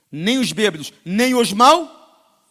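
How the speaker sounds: full-force sermon roar; fiery preaching. Acted. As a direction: hammer each sin from the pulpit.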